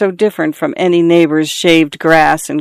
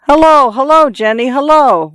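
calling out; 8 semitones higher than usual. A narrator's reading. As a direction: call out to her from afar.

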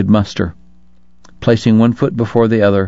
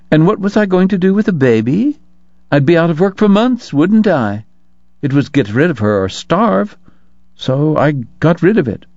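higher-pitched woman justifying herself; defensive young prostitute; 6 semitones higher than usual. A narrator's reading. Young woman confessing she got rid of a baby, defensive. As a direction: plead your case, pitched up.

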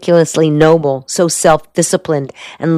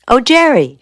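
higher-pitched glad exclamation; exclaiming; 8 semitones higher than usual. A narrator's reading. Helen greeting Jerry at the door, exclaiming. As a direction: greet him with a delighted, lifted cry. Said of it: "she exclaimed".

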